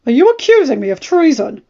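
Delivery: mild furious Ned. A narrator's reading. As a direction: throw the accusation back in anger. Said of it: "Ned asked furiously".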